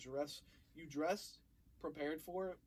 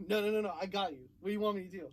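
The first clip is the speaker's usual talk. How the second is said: eager reassurance; 4 semitones higher, 8 dB louder, faster than usual. Retold or imitated. Eager pleading to coworker.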